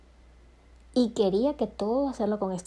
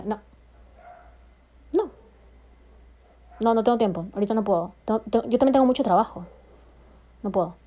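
cold brush-off; 4 dB louder, faster than usual. Retold or imitated. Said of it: "yo era brava y altanera".

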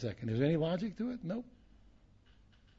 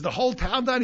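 shocked; 10 semitones higher, 10 dB louder than usual. Retold or imitated. Host's shock in joke.

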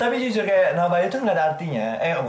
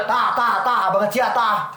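louder "Tah, tah, tah, banget sia, tah" in a Sundanese lilt; louder playful Sundanese imitation; Sundanese intonation; 4 semitones higher, 5 dB louder than usual. Shown as a demonstration. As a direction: hit each "tah" like a Sundanese speaker. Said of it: "Gitu ya?".

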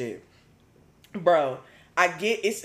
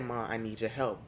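quiet plea; 7 semitones lower, 11 dB quieter than usual. Retold or imitated. Asking mom quietly.

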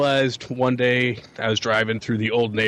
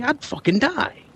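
exaggerated exasperation; 13 semitones higher than usual. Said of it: "I was like".

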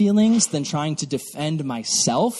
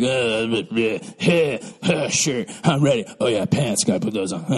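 low dopey grunts and half-words; lazy, low caveman-like morning voice; groggy oblivious guy; 8 semitones lower than usual. Acted. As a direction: mumble like a guy barely awake. Impression of guys getting ready.

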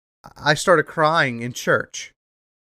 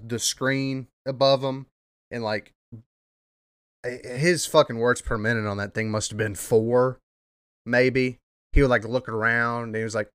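ordinary talk

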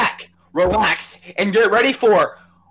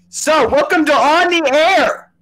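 announcer voice; 7 semitones higher, slower than usual. Acted. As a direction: announce the show's title grandly. Show-opening announcement.

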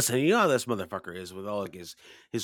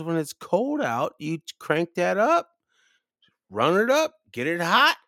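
emphatic insistence; 8 semitones higher, 4 dB louder than usual. Retold or imitated. Pap telling cabin guest how to heat, emphatic. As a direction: drive each phrase upward and louder as it goes.

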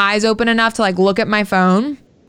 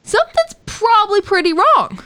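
higher, offended and insistent; mock-outraged protest, climbing higher; indignant retort; 9 semitones higher than usual. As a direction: put them in their place, pitched up. Her indignant reply to friends' texts.

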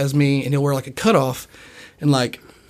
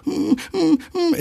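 high-pitched little dog whine; high, humming whimpers like a timid dog; 14 semitones higher, slower than usual. Retold or imitated. Dog owner imitating his dog staying close, high whimper.

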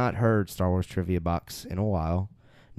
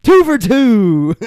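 higher, louder, slow hype call; excited announcement; 13 semitones higher, 13 dB louder, much slower than usual. Real, not acted. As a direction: announce it like a game show host. Host hypes correct answer.

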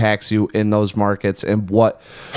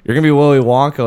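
ordinary talk, nothing marked

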